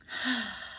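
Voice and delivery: long, soft weary sigh; deep sigh. Acted. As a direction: breathe out heavily before acting. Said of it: "took a deep breath".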